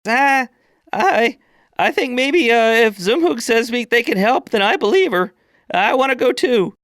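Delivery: high, hesitant youthful voice; a timid teen speaking up; shy young orc. Acted. Young orc timidly backs the priestess, high and hesitant. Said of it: "young orc who stands in the back".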